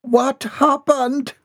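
very high, drawn-out horrified question; high, stretched-out alarm. A narrator's reading. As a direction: gasp the question high and slow in horror. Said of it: "her heart stopped in alarm".

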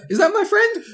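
teasing impression of a confused viewer; mock disbelief. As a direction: pitch it way up like a baffled fan. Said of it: "It was like".

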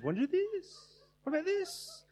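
"Wanna do this?" sung high and open; high open-ended lilt. Shown as a demonstration. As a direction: float it up, light and open. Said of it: "it says".

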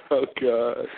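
ordinary delivery

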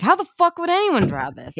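incredulous high-pitched complaint; exasperated outburst. Retold or imitated. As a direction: throw up your hands at an impossible drawing. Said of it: "you're like".

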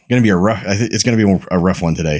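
ordinary talk

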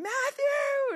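put-on a frazzled parent hollering a kid's name; yelling a name. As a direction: shout across to get a child's attention. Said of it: "I'm gonna be yelling".

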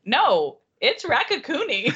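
loud, slow insistence on Raccacoonie; mock-adamant correction. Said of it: "she's like".

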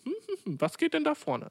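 very high-pitched curious busybody voice; nosy village neighbour. Retold or imitated. Storyteller imitating the village neighbour looking over, mock nosy. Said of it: "du weißt, wie Dorfnachbarn sind".

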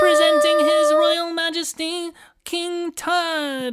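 high, slow royal herald announcement; pompous fussy courtier. Pretend. Announcing the king's entrance.

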